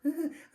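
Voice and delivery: high, jittery nervous titter; nervous laugh. Acted. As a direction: laugh to cover fear. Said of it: "gave a nervous laugh".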